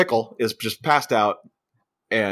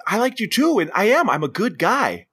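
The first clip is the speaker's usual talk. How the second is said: higher earnest plea; mock pleading; 9 semitones higher than usual. Boy pleads with the girl, higher mock plea. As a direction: beg her to believe you. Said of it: "he's like".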